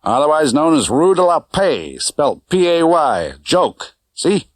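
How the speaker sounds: a hint of a breezy Vegas cabbie; driver Correa. A narrator's reading. Driver Correa voiced by narrator.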